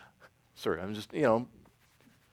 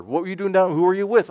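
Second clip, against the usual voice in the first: lightly voiced questioning dad, higher and louder; grilling parent; 7 semitones higher, 6 dB louder than usual. Dad questions a child home late, grilling. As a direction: fire off a dad's questions.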